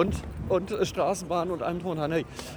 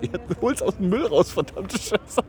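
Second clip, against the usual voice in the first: higher-pitched mock exasperation, swearing; exasperated retold outburst; 7 semitones higher than usual. Retelling the seller scolding a drunk passenger, exasperated. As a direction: act out a fed-up seller losing it. Retold quarrel over binned ticket.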